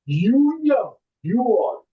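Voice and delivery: normal talking voice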